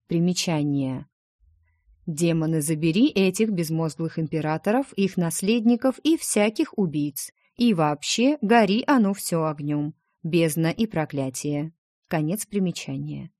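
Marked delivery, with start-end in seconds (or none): none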